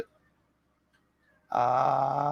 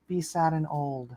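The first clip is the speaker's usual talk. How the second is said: softer, dreary mock voice; mock sad and old; 11 dB quieter than usual. Streamer mocking Eden's wish, softer and dreary. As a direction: sound tired and old on purpose. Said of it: "that's what she wants from me".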